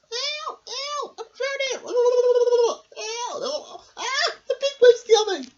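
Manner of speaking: very high, squealing cries for help; mock panic. Miranda, in difficulties, squeals for help as the wave comes. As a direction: wail like a kid in the water.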